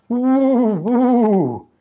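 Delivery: high, loud wind howl; two long swooping woos like a gale. A narrator's reading. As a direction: whoo like the blowing wind. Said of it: "Winds blew".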